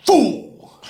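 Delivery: high, loud, drawn-out "Fool"; hammed-up video game shout; Mortal Kombat fool. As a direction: bellow "Fool" like the game announcer. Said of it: "There's that part where he's like".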